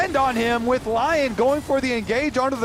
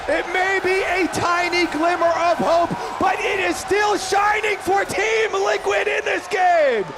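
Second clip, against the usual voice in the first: excited shout; 9 semitones higher than usual. Real, not acted. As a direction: scream the comeback with full hype. Caster erupts over comeback.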